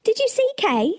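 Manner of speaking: Gerda asking. Acted. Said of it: "she asked".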